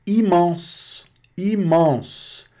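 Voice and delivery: immense with oral i and nasal en at the end; French immense. Shown as a demonstration. Teacher modelling immense in French, lifted pitch. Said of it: "é N aqui, olha, aqui sim".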